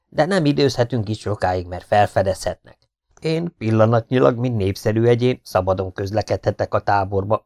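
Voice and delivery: plain reading voice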